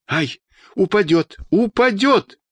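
high-pitched cry of alarm. Acted. Onlooker watching an old woman on a ladder, alarmed cry. Crowd fearing a woman will fall.

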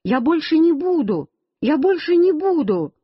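put-on high-pitched child's cry of protest; frantic little boy's promise, pitched high. A narrator's reading. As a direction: cry it out high, falling away at the end. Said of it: "стал кричать".

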